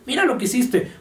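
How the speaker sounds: high-pitched mock scolding of the dog; imitating an owner scolding the puppy, pitch up.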